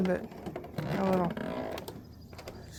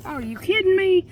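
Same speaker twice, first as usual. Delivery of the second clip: much higher, incredulous cry; can't believe it just happened; exasperated disbelief; 10 semitones higher than usual. Real, not acted. Fisherman losing his catch, high exasperated disbelief.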